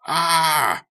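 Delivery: loud high frustrated ah; exasperated cry. Acted. Becca breaks away from the crowd, exasperated cry. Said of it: "exasperated cry".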